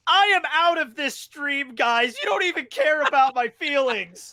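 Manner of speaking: high, loud mock outrage; hammed-up huff, almost shouting; mock indignant. Mock walking out of stream.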